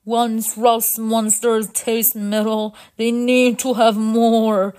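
slow robot-leader voice; heavy, drawn-out robot delivery; resigned sigh. Acted. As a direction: explain the doom wearily. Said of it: "Sparkin sighed".

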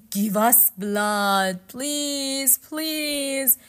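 high, loud ghostly wail; pleading ghosts. Acted. Ghosts beg to cross the river, wailing. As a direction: beg desperately, stretching each plea.

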